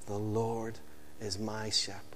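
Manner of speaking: soft closing echo of the psalm; hushed. Shown as a demonstration. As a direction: let it fall to a near whisper. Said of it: "closing refrain, hushed".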